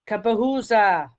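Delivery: loud rallying shout; soldiers' loud war cry as they burst in; battle cry. Acted. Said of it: "they cry".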